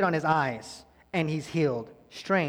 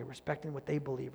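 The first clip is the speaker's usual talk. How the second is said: normal talking voice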